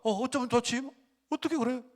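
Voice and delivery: high-pitched mock distress for someone's plight; imitated tearful sympathy. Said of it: "막 울어요".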